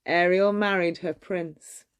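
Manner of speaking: ordinary delivery, nothing marked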